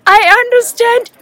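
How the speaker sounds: put-on high, loud delighted cry of a little boy; excited child. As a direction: burst out like a thrilled seven-year-old. Excited child's exclamation retold.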